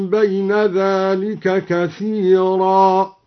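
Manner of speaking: slow chant, ثمودا ending in a long a; stop on ثمودا with alif. Shown as a demonstration. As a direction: recite ثمودا with final alif. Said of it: "وإذا وقف وقف بالألف هكذا". (الوقف على ثمودا بالألف)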